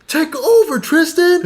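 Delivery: high-pitched mock yell at a friend; re-enacted yelling. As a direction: imitate hyping someone up from the crowd. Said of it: "I was just yelling at him".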